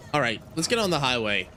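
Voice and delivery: ordinary delivery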